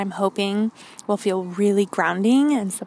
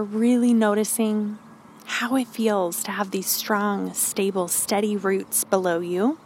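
calm grounding exercise. Acted. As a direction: guide them gently into stillness. Leads grounding meditation.

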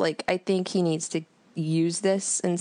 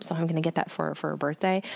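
ordinary delivery

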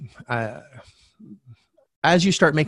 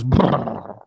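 loud mimicked "brr"; a burst of brr, copying a stranger; imitated homeless man's noise; 4 dB louder than usual. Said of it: "I remember that sound".

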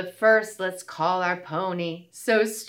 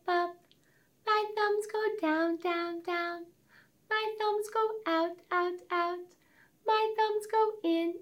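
much higher, softer tiny fairy voice; wee little fairy; 11 semitones higher, 5 dB quieter, slower than usual. Pretend. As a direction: shrink into a tiny fairy, high and soft. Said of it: "sounding like a wee little fairy".

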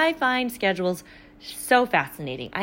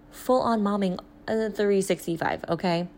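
ordinary talk, nothing marked

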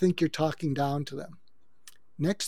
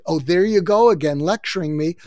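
louder, exasperated jab at a lecturer; irritated accusation; 8 dB louder than usual. Acted. Fed-up spouse snapping back at the know-it-all, loud and exasperated. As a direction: throw it back at him like a fed-up partner. Acted spouse retort in quarrel.

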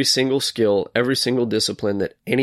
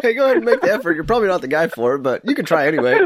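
higher, louder, through laughter; joking mock encouragement; 8 semitones higher, 4 dB louder, faster than usual. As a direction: egg them on, laughing as you go. Acting out friend's call amid laughter.

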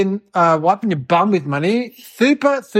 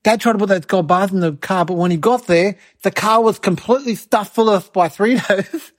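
ordinary delivery

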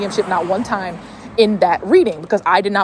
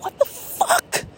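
slow, breathless, very high whisper of shock; a whispered high what-the-fuck of shock; stunned; much slower than usual. Retold or imitated. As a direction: gasp it out in disbelief. Psychic knows about the training.

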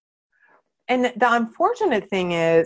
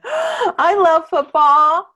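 gasping, higher, louder, drawn-out fake delight; mock gushing; 6 semitones higher, 5 dB louder, slower than usual. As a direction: overdo the fake enthusiasm to win the guy.

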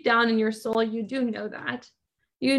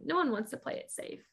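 ordinary delivery

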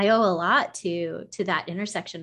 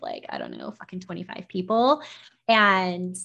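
ordinary talk, nothing marked

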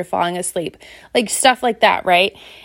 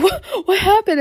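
alarmed husband; 11 semitones higher than usual. Retold or imitated. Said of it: "He's like".

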